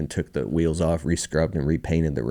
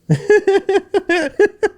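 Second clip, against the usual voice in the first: high, loud burst of laughter; real laugh; 7 dB louder than usual. Real, not acted. Guest laughing off near tears on a podcast, laughing. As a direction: laugh out in relief after nearly crying.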